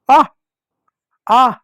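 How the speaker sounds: a drawn-out आ like someone yelling when hurt; mock cry of pain. Shown as a demonstration. Teacher showing children the आ sound as a cry of pain. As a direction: stretch आ out like a shout of pain, lightly. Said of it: "ऐसा चिल्लाते हैं ना".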